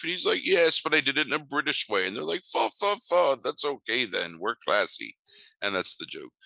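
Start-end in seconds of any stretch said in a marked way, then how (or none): 0.4-2.1 higher mock British toff voice; mock British accent. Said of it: "three British guys like". 2.4-5.2 mock British accent. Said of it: "three British guys like".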